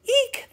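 high squeaky eek; tiny high-pitched squeal; mouse squeak. Acted. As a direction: squeal like a startled wee mouse.

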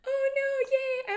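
high, loud mock alarm turning to delight; excited high-pitched outburst; playful excitement. Real, not acted. Playful reaction to security check.

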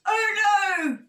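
high, loud cry of alarm; hammed-up storybook panic; dismayed shout. A narrator's reading. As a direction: yelp it out in mock horror. Storybook bear in sudden dismay.

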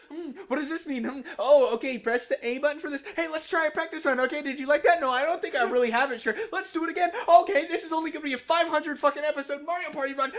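high, loud mock gameplay chatter; high-pitched mocking playacting; sarcastic reenactment. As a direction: act out a tedious practice run sarcastically. Mock demonstration of practice runs.

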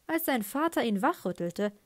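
plain reading voice

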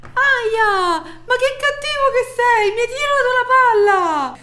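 aia acuto e offeso, molto più alto; colpita, lamento acuto. Acted. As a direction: strilla di dolore e protesta. Colpita dalla palla, protesta. (grido acuto di dolore finto)

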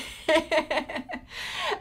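real, high-pitched giggle; amused laugh. Real, not acted. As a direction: burst out laughing at your own confusion. Amused at not knowing the pronunciation.

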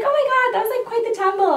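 girl on the boat reacting to a fall, high and chirpy; girly voice. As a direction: chirp it like a sweet young girl.

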